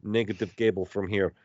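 put-on light Russian accent, tutorial tone; a Russian accent, hinted at. Friend imitating Russian YouTube mechanic, light Russian accent. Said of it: "this Russian dude".

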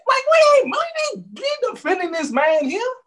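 high, loud burst of protest; heated outburst. Real, not acted. Insisting to listeners we won't defend him, high heated protest. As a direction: push the pitch way up as the point boils over.

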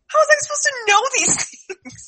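high indignant protest. Retold or imitated. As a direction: plead innocence to mom. Protesting to mom, retold.

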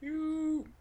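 high, wailing melodramatic accusation; Paul Bearer. Acted. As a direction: accuse in a ghoulish falsetto wail. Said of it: "What does Paul Bearer say?".

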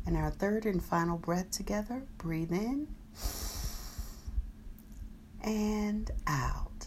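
soft, slow breathing cue; guided meditation. Acted. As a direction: breathe the words out gently.

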